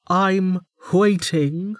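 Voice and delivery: high, slow and impatient; impatient warden. A narrator's reading. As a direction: make him feel the wait.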